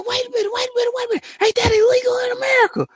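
reenacting his own shocked reaction, high pitched; shrieking disbelief. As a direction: screech it like you cannot believe your eyes. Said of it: "And I said".

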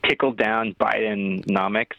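drawn out slowly, every word separate; slow and clear. Shown as a demonstration. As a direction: spell it out slowly for him. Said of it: "Say again?".

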